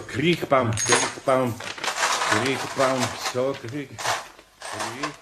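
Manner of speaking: trailing off into a mumble while searching; fading mutter. Real, not acted. Carpenter mumbling the name as he searches the phone book, fading.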